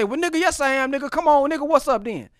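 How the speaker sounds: confrontational. Acted. Acting out the wrong reply to an insult, confrontational. Acted wrong, heated comeback.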